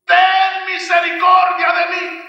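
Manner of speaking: high, desperate shout; crying out again. Acted. Blind man shouting again to the passing Lord: pleading yell. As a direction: call out with everything you have. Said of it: "le volvió a gritar".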